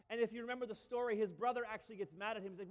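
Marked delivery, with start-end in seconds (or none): none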